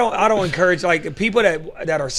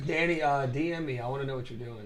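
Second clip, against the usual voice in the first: far from mic; 3 semitones lower, 5 dB quieter than usual. Real, not acted. Speaker moves off mic.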